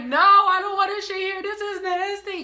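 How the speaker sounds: high, wailing protest; hammed-up crying whine; mock crying. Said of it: "I was crying".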